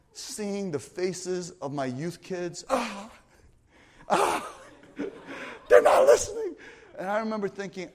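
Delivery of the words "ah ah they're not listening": higher, louder cry of frustration; frustrated yelled lament, higher and louder; frustrated outburst. Retold or imitated. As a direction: vent the despair after the youth night. Retold despair driving home.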